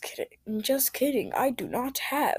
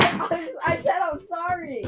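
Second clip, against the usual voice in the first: whiny plea; 9 semitones higher than usual. Pretend. Kid pleading with grandma.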